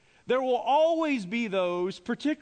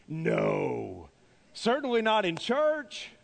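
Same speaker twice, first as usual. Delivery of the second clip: sarcastic; slower than usual. Acted. Preacher jokes about critical people in church, sarcastically. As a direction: feign innocence with heavy sarcasm. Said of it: "sarcasm's my spiritual gift".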